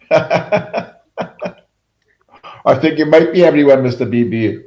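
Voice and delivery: gleeful accusing banter; teasing excitement. Real, not acted. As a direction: tease him with a grin. Teasing chat viewer about aliases.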